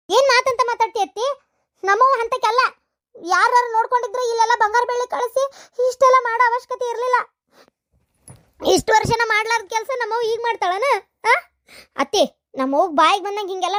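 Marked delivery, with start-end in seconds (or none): 0.1-11.3 raised, higher voice in hurt outrage; indignant protest. Acted. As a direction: defend your mother, voice climbing. Niece defends mother to aunt.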